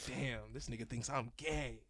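under breath. Retold or imitated. Inner thought, under the breath.

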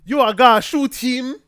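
loud, high, hammed-up Jamaican accent; mock Jamaican accent. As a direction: shout it in bad TV Jamaican. Mocking bad Jamaican acting.

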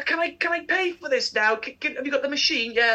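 normal talking voice

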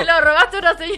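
fast, loud, laughing words. Real, not acted. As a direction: rush it out while laughing. Laughing at the joke.

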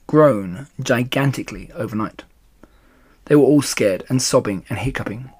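plain reading voice

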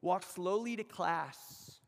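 drawn out and unhurried; slowly. Shown as a demonstration. Preacher to the students, a slow unhurried urging. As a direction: stretch every word to show the pace.

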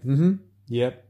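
quick offhand "Mm-hmm, yep"; casual mumbled reply. Shown as a demonstration. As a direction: toss off a lazy "yep".

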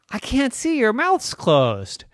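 put-on high little-kid complaint; complaining child. Irene can't see into Mommy's mouth, childish complaint.

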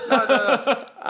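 high laughing no. Real, not acted. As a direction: laugh the no out loud. Laughing denial.

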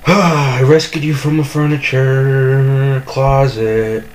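slow, drawn-out mock-grand declaration; mock dramatic. Telling Jamie how he rescued him, slow mock-grand delivery. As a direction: intone it like an epic speech.

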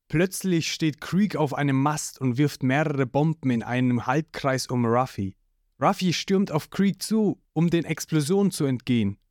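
ordinary delivery

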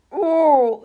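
high, loud acted heave; retching. A narrator's reading. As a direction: throw up with one drawn-out "ooh". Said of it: "übergab sich Rudi wieder".

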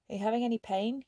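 a worried question asked aloud. A narrator's reading. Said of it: "he asked aloud".

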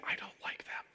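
hushed inner thought; whispered aside. Retold or imitated. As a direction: whisper the true opinion aside. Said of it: "that's me saying".

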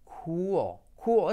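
"cool" as KOO-ol; pronunciación correcta. Shown as a demonstration. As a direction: glide "cool" into a dark l, like another syllable. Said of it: "otra sílaba distinta". ("cool" como «cúol»)

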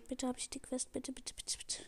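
soft, hushed, desperate begging; pleading whisper. Real, not acted. Gamer begging for the quest, hushed pleading whisper. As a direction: whisper the pleas, almost breathless. Hoping for the quest at match end.

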